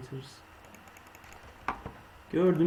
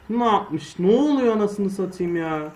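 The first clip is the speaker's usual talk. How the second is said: higher and louder angry rant; raging; 5 semitones higher, 6 dB louder than usual. Real, not acted. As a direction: rage at the game. Raging at game. (öfkeli bağırış)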